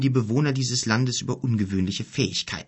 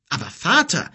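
higher, louder startled protest; alarmed; 9 semitones higher, 5 dB louder than usual. A narrator's reading. Son protests to his father, alarmed. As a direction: cry out in alarm. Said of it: "rief Ado erschrocken".

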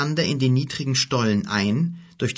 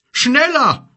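hoch und laut gebrüllt; 13 semitones higher, 8 dB louder than usual. A narrator's reading. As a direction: mit aller Kraft antreiben. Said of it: "brüllte Gork". (Gork treibt die Gefährten an, gebrüllt)

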